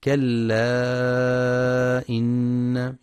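كلا with its final ا stretched four or five counts before إن; separated madd. Shown as a demonstration. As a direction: hold the end of كلا long, then إن. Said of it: "للمد المنفصل". (مد منفصل أربع أو خمس حركات)

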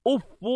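أف ending in short "u", "uffu"; damma reading. Shown as a demonstration. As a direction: say أف carefully as "uf-fu". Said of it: "جعله بمنزلة مد".

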